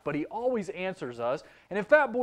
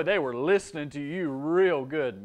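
ordinary delivery

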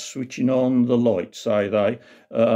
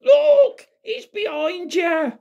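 high, drawn-out mock shout; panto audience shout; slower than usual. Panto audience warning Buttons of the ghost, high mock shouting. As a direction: imitate kids calling out, very high and slow. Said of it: "they'd start shout".

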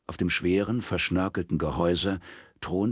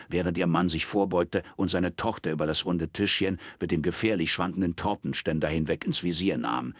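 ordinary talk, nothing marked

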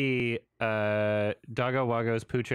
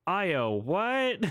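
higher-pitched baffled movie-dog line; hammed-up confused question; talking dog's mock confusion; 6 semitones higher than usual. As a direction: quote a puzzled cartoon dog. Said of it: "And the dog says".

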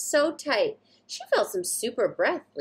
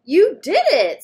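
much louder, proud cheer; exclaiming; 7 semitones higher, 11 dB louder than usual. Acted. Dad cheering Satya after the slide, loud and proud. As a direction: cheer your kid on. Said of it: "Super Satya's dad exclaimed".

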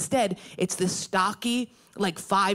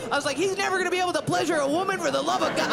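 higher, fast taunting shouts; hollering; 8 semitones higher, much faster than usual. Retold or imitated. Comic taunting the dad, fast raised shouts. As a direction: jeer at the men walking away. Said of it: "We're hollering at each other".

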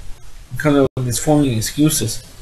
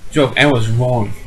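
ordinary talk

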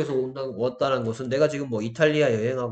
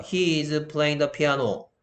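very slow, deliberate English example; slow model sentence; much slower than usual. Shown as a demonstration. Teacher reading an example sentence to students, slowly. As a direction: spell the sentence out word by word.

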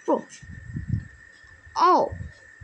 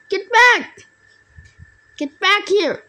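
high, raised chasing yell; calling out; 6 semitones higher, 3 dB louder than usual. Real, not acted. Gamer to fleeing opponent, get back, high raised yell. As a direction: shout after someone getting away.

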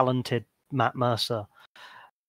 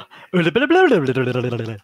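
mock Norwegian name; 9 dB louder than usual. Shown as a demonstration. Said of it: "I think you sneezed".

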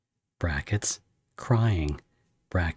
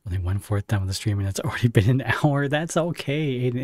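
usual speaking voice

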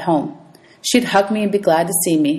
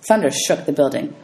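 usual speaking voice

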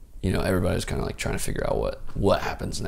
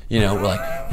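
ordinary delivery

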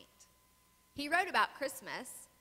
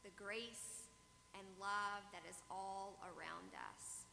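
soft, hushed prayer voice; 3 semitones lower, 17 dB quieter than usual. Acted. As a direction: pray it quietly and reverently. Opening prayer, far softer.

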